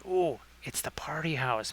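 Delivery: hushed, breathy whisper of a stoner line; whispered quote. Retold or imitated. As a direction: whisper it like a secret aside. Retold quote, muttered aside.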